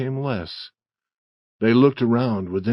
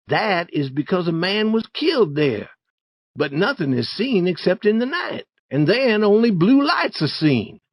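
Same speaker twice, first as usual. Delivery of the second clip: put-on very high-pitched young boy voice; persuading Tom; 9 semitones higher than usual. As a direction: coax a wary friend in a high voice. Story figure Tom explains.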